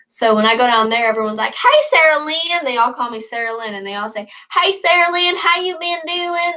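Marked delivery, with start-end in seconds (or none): 1.5-2.6 imitating relatives' Southern drawl, higher; a friendly Southern greeting in a put-on Southern accent. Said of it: "They have this southern accent". 4.5-6.5 put-on relatives greeting her in a Southern accent. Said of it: "They have this southern accent".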